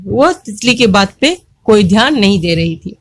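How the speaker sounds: plain reading voice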